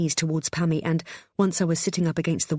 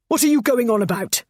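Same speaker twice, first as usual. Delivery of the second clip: loud, higher angry bark; 7 semitones higher, 6 dB louder than usual. A narrator's reading. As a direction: bark it at her. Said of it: "barked Adam".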